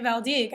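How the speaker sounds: Val d'Ig cut to two syllables, val-DEEG; earlier mispronunciation. Shown as a demonstration. Host admitting her attempt a day ago, Val d'Ig as val-DEEG. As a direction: end Val d'Ig on a hard g. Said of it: "I was pronouncing it".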